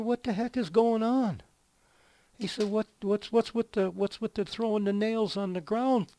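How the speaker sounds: mock-indignant boss voice, high and loud; incredulous foreman. Foreman challenging worker over thrown nails, incredulous.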